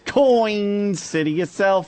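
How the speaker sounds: COIN drawn out high and slow; mock mispronunciation. Co-host imitating a terrible mispronunciation, exaggerated mock accent. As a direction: stretch Coin into a long, high, sing-out "Coin". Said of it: "That's the worst mispronunciation I've ever heard".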